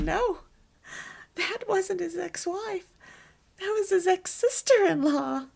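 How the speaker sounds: high, loud retold exclamation; emphatic correction. Retelling her laughing correction to husband.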